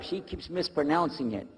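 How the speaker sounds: usual speaking voice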